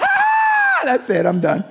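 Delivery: sudden high mock yell, then laughing; mock dying Moses. Preacher voicing an obliterated Moses with a hammed-up high yell. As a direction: ham up Moses being wiped out. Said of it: "He would have been like".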